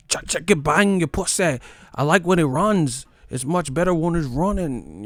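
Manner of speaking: high mock British accent, pompous preference; mock British fan. Mocking British fans claiming they prefer boxing, high mock accent. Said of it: "they switch up".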